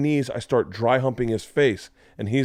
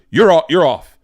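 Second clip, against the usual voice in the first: louder, higher mock-angry shout; imitated angry dismissal; 6 semitones higher, 8 dB louder than usual. Offended host before he storms off, imitated angry shout. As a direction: imitate someone furiously ordering a person out. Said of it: "storms off".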